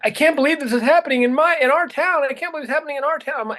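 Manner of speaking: high, fast panicked mother quote; retold freaking out. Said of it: "she was freaking out".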